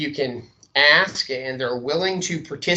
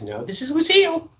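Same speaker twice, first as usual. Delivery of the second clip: much higher gatekeeper woman; high-pitched receptionist answering; 11 semitones higher than usual. Acted. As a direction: raise the voice high to play the receptionist. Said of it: "turn to be the gatekeeper".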